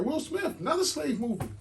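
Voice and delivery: high-pitched, incredulous griping; mock exasperation. Mock complaint at another slave movie.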